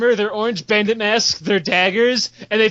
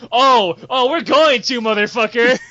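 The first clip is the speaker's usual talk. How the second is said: raised, pushed comeback; defiant outburst; 2 semitones higher, 4 dB louder than usual. Acted. Guild leader defying the GM's warning, defiant outburst. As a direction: throw it back loudly as a challenge.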